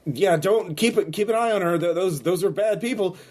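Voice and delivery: high, flustered and scared, tripping over words; an NPC warning the players about bad people, panicky; panicked stammer. Frightened, high stammer.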